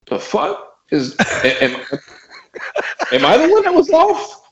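high, unsure inner voice; doubting myself. Retold or imitated. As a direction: question yourself mid-groove, rising high. Self-doubt retold as inner voice.

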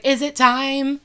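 gross raspy smoker voice; smoker's rasp. Retold or imitated. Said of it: "In a really gross, like, smoker's voice".

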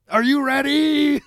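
high, slow macho-man hype call. Acted. Host acting Jackson rousing his friend, macho-man hype voice. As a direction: draw it out like a macho wrestler. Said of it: "That was kinda macho manish".

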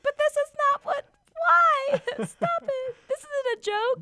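high, squeaky panicked voice; squeaky body voice. Pretend. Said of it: "My body's like".

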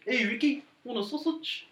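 high-pitched playful character voice; sing-song the offer, high; high mock voice. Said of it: "it was a relaxing song".

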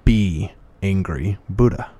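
slow, spaced-out words in imitation of a monk; measured monk's reply. Monk answering the angry man across the aisle, slow and deliberate. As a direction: pause after each phrase, letting it land like a teaching.

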